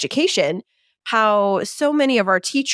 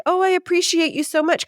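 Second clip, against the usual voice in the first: high-pitched mock gushing praise; gushing appreciation; 10 semitones higher than usual. Boss handing an attaboy to staff, mock gushing. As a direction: imitate an effusive boss's thanks.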